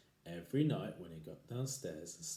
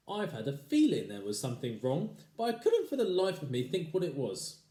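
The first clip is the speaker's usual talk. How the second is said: higher, louder fussing wife voice; a worried wife, pitched up; fretful old wife; 6 semitones higher, 7 dB louder than usual. Acted. Wife's voice in story.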